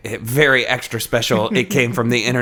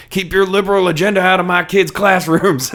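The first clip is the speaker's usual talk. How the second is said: higher, louder mock angry rant; mock yell; 9 semitones higher, 4 dB louder than usual. Stern dad character yelling about classrooms, mock angry yell. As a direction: yell it like an outraged dad at a meeting. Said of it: "yelling about".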